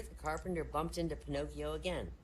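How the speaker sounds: ordinary talk, nothing marked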